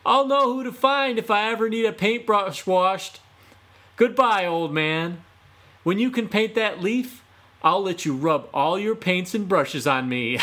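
high, loud gloating yell; yelling back. Acted. Fox yelling back at Autumn as he runs, gloating. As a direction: shout it over your shoulder, mocking. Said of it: "he yelled back".

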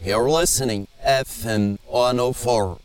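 reversed playback of backward speech; played backwards. Shown as a demonstration. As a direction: audio flipped back to forwards. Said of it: "I'm just gonna play that backwards for you now".

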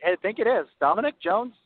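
coy, sweet, innocent voice; simpering. Pretend. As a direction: play coy, like nothing happened. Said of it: "You sound so coy".

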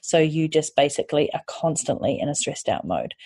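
ordinary talk, nothing marked

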